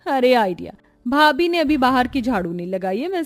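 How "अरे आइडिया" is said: ordinary talk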